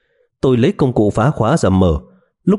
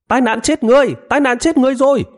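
high piercing panicked shout. Acted. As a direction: scream it out in alarm. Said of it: "hô to".

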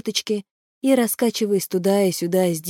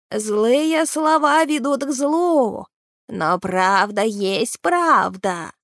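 creature voice; 4 semitones higher, much slower than usual. Acted. Creature Gragi answers Naal.

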